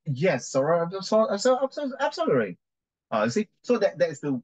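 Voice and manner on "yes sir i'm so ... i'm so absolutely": hammed-up witness, voice climbing high; witness role-play. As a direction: answer counsel as an acted witness, rising much higher. Acts Missus Salmon answering in court.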